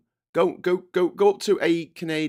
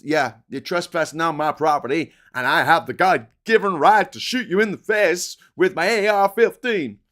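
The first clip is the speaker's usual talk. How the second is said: put-on American accent; 4 semitones higher, 5 dB louder than usual. American homeowner threatening a trespasser, exaggerated American accent. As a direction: bellow a caricature of a gun-toting American. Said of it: "I won't do an American accent again".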